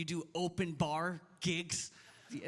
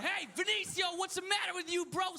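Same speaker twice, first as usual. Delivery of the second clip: high-pitched mock yell at his son; retold yell; 11 semitones higher than usual. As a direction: yell it like a dad acting out his outburst. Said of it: "So I yell, I'm going".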